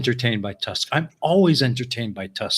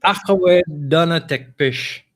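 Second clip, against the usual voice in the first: learner's imitation of a Scot; attempted Scottish accent; 3 semitones higher than usual. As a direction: copy the Scots phrase, not quite managing it. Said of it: "See, I can't do it".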